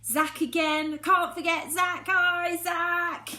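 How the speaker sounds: plain reading voice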